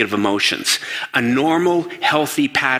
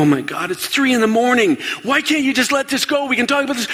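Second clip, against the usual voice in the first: high, weary pleading; exasperated plea; 14 semitones higher than usual. Retold or imitated. Partner at three in the morning, pleading high and exasperated. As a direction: beg them to drop it, fed up. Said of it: "The other person's gonna go".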